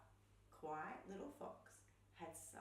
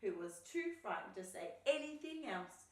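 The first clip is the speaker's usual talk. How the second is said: ordinary delivery, nothing marked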